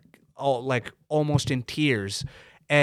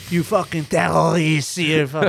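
hammed-up Quebec French accent, louder, rolling the r's; mock Quebec accent; 3 semitones higher, 5 dB louder, slower than usual. Angry Quebec customer yelling at a brown family, mock Quebec accent. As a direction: spit it out like an angry Quebec man. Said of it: "That's the worst Quebec accent".

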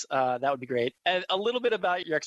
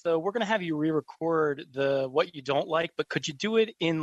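ordinary talk, nothing marked

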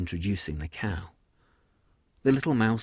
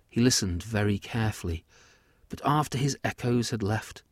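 usual speaking voice

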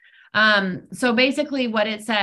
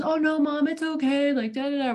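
son's reply; 7 semitones higher than usual. Retold or imitated. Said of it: "if he goes".

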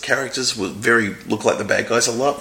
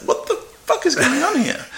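bewildered; 13 semitones higher than usual. Retold or imitated. Retelling own bewildered reaction.

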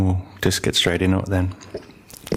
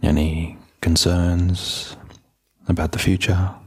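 low, even meditation voice with long pauses; calm, unhurried settling-in voice; guided meditation; 4 semitones lower, slower than usual. Real, not acted. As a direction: gently guide listeners inward, leaving space between phrases.

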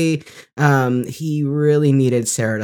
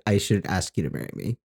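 lightly voiced Jackson; 5 semitones lower, 8 dB quieter, faster than usual. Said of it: "And he said".

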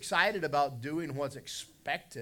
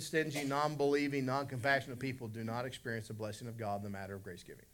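ordinary delivery

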